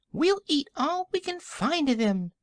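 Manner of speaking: very high little piglet squeak. A narrator's reading. Another piglet answers about the fruit, high squeaky voice. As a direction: pipe up like an eager tiny pig. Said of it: "said another".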